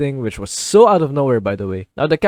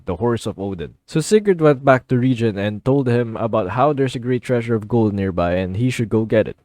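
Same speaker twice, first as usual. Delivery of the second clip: usual speaking voice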